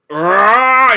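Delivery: loud straining roar; powering-up roar. Acted. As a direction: growl as power surges. Natsu powering up.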